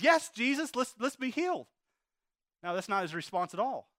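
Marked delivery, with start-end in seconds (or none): 0.0-1.7 excited, higher mock cheer; imagined eager reply. Preacher imagining the invalid's joyful answer to Jesus, excited. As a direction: act out jumping for joy, voice lifted high. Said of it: "he jumps up and down and says".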